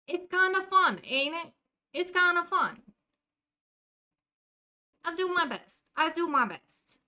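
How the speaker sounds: put-on cheerful New Jersey accent to the chat; a light Jersey accent. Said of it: "how am I doing?".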